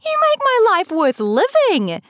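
very high, loud, gleeful squeal; high-pitched, overjoyed squealing. A narrator's reading. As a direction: cry it out in delighted hysterics. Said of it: "Lily squeals".

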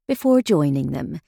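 plain reading voice

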